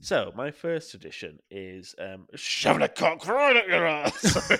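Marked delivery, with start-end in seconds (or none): 2.4-4.5 mock announcement. Prank joke in the tradition list.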